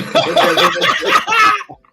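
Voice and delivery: loud, high cackling laugh; real high-pitched burst of laughter; helpless laughter. Real, not acted. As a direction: crack up completely. Cracks up at punchline.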